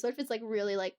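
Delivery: ordinary talk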